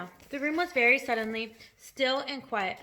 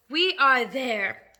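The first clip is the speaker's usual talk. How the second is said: loud, slowly stretched announcement; forced cheer; 8 dB louder, much slower than usual. A narrator's reading. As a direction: try to sound cheerful, very slowly. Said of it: "trying to be cheerful".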